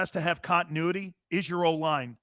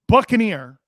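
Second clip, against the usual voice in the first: ordinary delivery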